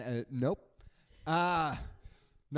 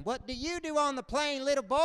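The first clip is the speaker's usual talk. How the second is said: put-on condescending old veteran; 9 semitones higher than usual. Veteran mocks young pilot.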